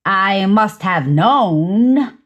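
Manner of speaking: very slow, drawn-out mimicry; draggingly slow mock repeat; mimicking the partner's phrasing. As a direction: stretch each word out, echoing how the partner says it. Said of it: "Make me say it like you".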